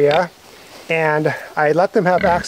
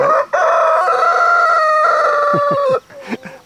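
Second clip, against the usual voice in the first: high-pitched laugh. Real, not acted.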